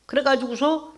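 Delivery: normal talking voice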